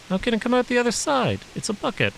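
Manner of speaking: put-on high, young boy's voice; boy asking in disbelief; child voice. A narrator's reading. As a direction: raise the voice for a curious little boy. Boy character voiced in story.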